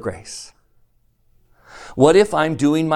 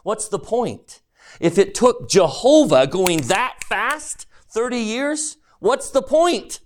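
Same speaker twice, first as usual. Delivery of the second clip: mock whine; 9 semitones higher than usual. Mocking a slow complaint.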